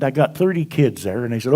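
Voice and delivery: usual speaking voice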